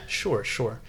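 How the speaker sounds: hushed, lightly voiced impression; a soft, understated imitation of a co-host; James. Co-host slipping in a quiet impression of James, lightly imitated. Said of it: "that was my, uh, James impression".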